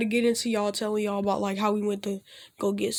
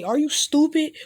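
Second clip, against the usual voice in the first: heated snap; 6 semitones higher, 7 dB louder than usual. Acted. Naruto get heated.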